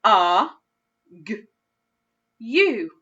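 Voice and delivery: A, g, u said as separate sounds; sounding out. Shown as a demonstration. Teacher sounding out argue for a child. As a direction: segment A, g, u one at a time. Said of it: "Let's read it with that u sound".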